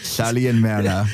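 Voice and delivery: lightly voiced old man, laughing; a hint of a creaky old wrestler. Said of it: "in his best old man voice".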